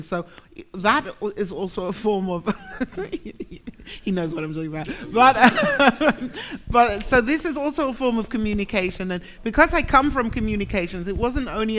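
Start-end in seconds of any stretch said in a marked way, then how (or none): 2.5-4.0 laugh. Real, not acted. Laughing at a listener's reaction. 5.1-9.1 talking through a real laugh. Real, not acted. Laughing while speaking.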